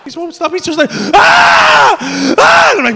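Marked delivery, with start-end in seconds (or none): 0.9-2.7 high, loud drawn-out mock scream; imitated screaming. Comedian imitating his friend who won't stop, piercing screams. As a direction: keep screaming at full pitch. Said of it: "Mate, stop screaming".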